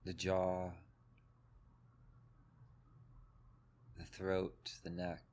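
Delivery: calm, even meditation voice with long pauses; guided meditation. Acted. Teacher leading a group through breath and body, guided meditation. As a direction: guide them gently into the body, leaving space between phrases.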